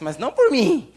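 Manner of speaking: hammed-up false modesty; mock humility. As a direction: play the fake humble believer, pushing it up high.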